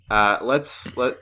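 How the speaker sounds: ordinary delivery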